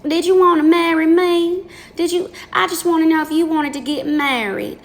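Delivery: high, sugary put-on voice; mockingly timid, high-voiced request; mock polite questioner. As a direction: pitch it way up, overly polite and meek. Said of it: "that's how I talk. I, I talk like this".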